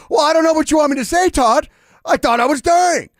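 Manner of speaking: put-on high, loud, fast cranky old man; defiant grandpa. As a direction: grandpa shrugging it off, sue me. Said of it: "funny little argument".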